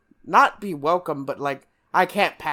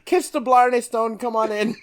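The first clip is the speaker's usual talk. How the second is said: high, cheery Irish brogue breaking into laughter; Irish guard welcoming him in with a laugh; Irish accent, higher and laughing; hammed-up Irish accent; 8 semitones higher, 4 dB louder than usual. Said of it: "that's Irish".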